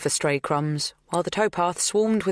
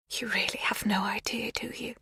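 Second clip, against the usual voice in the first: whisper; 7 semitones higher, 6 dB quieter than usual. A narrator's reading. Magda whispers confession.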